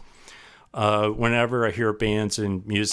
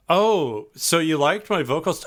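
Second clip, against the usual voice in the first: higher, tentative question; pleased surprise; 7 semitones higher than usual. Retold or imitated. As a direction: act out finding out your singing was liked. Acts out own pleased surprise.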